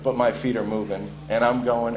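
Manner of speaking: normal talking voice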